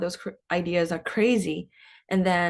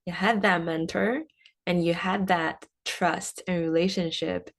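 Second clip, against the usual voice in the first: normal talking voice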